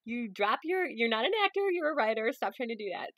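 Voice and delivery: loud, high teasing jeer at herself; mock heckler. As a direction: heckle the reader, pointing and scoffing. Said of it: "or just laugh at me, like, point".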